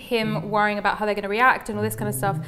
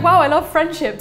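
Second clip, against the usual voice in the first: mock gushing; 5 semitones higher, 7 dB louder than usual. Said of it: "it went from being like".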